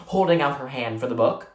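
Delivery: plain reading voice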